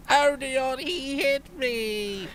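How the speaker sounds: long high crybaby wail; mock wailing. Slapped Merrick tattling to Aldeon, mock bawling. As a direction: bawl it out high and drawn-out.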